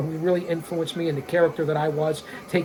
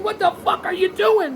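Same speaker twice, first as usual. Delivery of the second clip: much higher, incredulous yell at the wrestler; exasperated outburst; 11 semitones higher than usual. Real, not acted. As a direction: throw your hands up in disbelief. Yells at wrestler again.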